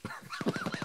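sudden high-pitched laugh; high burst of laughter. Real, not acted. Medic laughs at teammate.

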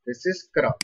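kra, the k half and the ra full. Shown as a demonstration. Teacher modelling kra for the class, k half, ra full. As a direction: run a half k straight into full ra. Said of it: "Where ko is half and ra full".

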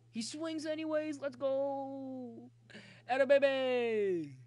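high, loud cheer with a drawn-out finish; excited cheering. Real, not acted. Gamer cheering on his team, excited drawn-out yell. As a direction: whoop it out as the play goes your way. Celebrates a swing in game.

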